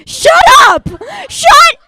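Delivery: high, loud laughing yell; real shout. Real, not acted. Comic spotting a friend in the audience, loud laughing yell. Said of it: "This is the person who sent me".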